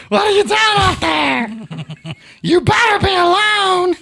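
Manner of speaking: high, loud, slow granny yell; grandma shout. Pretend. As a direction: holler like an angry grandma. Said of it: "She's gotta be".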